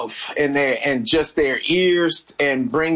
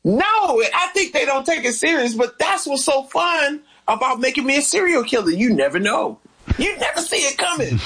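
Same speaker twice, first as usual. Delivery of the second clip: gleeful joking; 11 semitones higher than usual. Real, not acted. Jokes about serial killer role.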